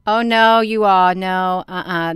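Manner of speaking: drawn-out, loud cry of dismay; loud disbelief. Real, not acted. Vlogger tells viewers about the docking station, loud dismay. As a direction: protest loudly to the viewers in disbelief.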